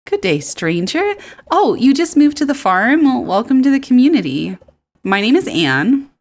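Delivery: high, loud, lively welcome; friendly townswoman Anne. Acted. Townswoman welcoming the new farmer, high and lively. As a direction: greet the newcomer with a big, high voice.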